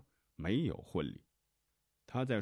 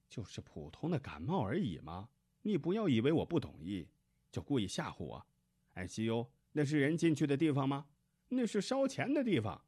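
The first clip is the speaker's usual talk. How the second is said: scornful protest, jumping much higher and louder; indignant mother; 8 semitones higher, 4 dB louder than usual. A narrator's reading. Patient's mother-in-law refusing the doctor's ICU advice, indignant and scornful. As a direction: narrator voicing an indignant, high-pitched objection.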